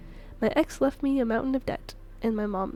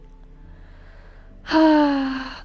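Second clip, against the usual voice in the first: heavy sigh; 2 semitones higher, 7 dB louder than usual. Acted. Weary mother's long sigh.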